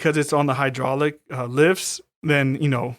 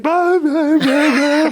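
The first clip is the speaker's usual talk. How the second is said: high, loud panicky gibberish; high frantic mock babble; 13 semitones higher, 6 dB louder, much slower than usual. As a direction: babble like a hysterical caller. Mocking the frantic phone call.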